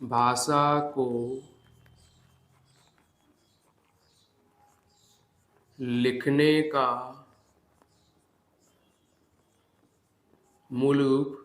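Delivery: slow, spaced dictation for notes; dictating to students. Acted. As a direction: read it out word by word for writing down. Dictating definition slowly.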